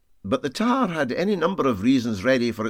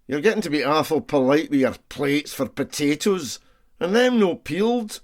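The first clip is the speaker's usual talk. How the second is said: lightly voiced Scots ship's cook, rising to a high mock-indignant finish; a touch of the indignant deckhand; cheeky Scots cook; 5 semitones higher, 4 dB louder than usual. A narrator's reading. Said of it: "said the tar".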